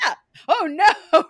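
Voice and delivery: high, loud gasp of alarm; hammed-up shocked cry; mock shock. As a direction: act out a viewer's horror. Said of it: "You're like".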